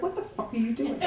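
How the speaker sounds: blunt, exasperated swearing; the real, unfiltered reaction; panicked sister swearing at brother; uncensored outburst. Retold or imitated. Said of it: "I said".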